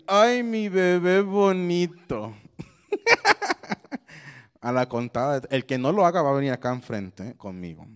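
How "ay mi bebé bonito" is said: put-on lightly cooing over a baby; slow, doting baby talk. Shown as a demonstration. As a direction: dote on a cute baby, drawn out. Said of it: "Y le vas a decir".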